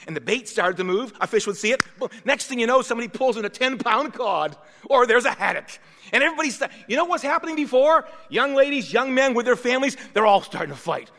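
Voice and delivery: preaching at full force. Acted. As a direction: preach it out to the congregation. Builds preaching about the catch.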